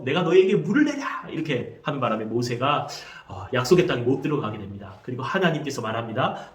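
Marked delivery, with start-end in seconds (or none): 0.0-1.3 acted angry Moses, pitched high; angry outburst. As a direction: raise pitch and cry out in mock anger. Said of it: "화가 나서 지팡이로 반석을". (화난 모세를 흉내 낸 외침)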